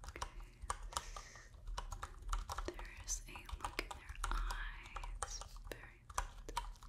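very soft whispered observations; ASMR whisper. Acted. Examiner noting a human's sad face, hushed ASMR whisper. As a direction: murmur notes to yourself, barely audible.

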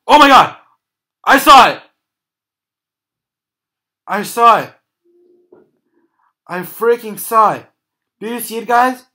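panicked screaming after jump scare; jump scare laugh. Real, not acted. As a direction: yell it in real fright. Jump scare in horror game.

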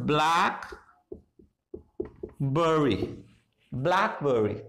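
"blackberry" said slowly with a weak "buh" in the middle; schwa in second syllable. Shown as a demonstration. Teacher confirming the schwa rule, "blackberry" pronounced slowly and deliberately. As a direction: draw out "blackberry" in parts, middle vowel as schwa. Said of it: "श्वा लगाएंगे".

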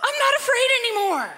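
put-on high, breathless kid voice, half laughing; imitating the boy from Home Alone with a laugh; Macaulay Culkin impression. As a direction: play the scared kid finding his nerve. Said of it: "you remember that movie when he goes".

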